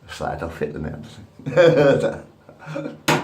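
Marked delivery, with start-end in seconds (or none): none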